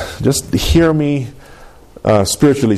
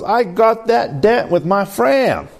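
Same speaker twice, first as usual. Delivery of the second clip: higher, mock Southern tow truck voice; a hint of Southern accent, pitched up as Mater; 9 semitones higher, slower than usual. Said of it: "a better, better accent than that".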